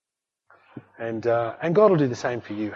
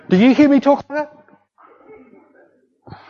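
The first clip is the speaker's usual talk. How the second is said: very high, loud, drawn-out protest; mock indignation; 11 semitones higher, 8 dB louder, much slower than usual. Husband chiding his wife for her unbelief, mock indignant. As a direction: squeal it in exaggerated reproach.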